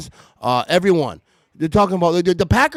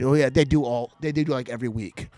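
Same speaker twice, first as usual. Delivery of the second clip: normal talking voice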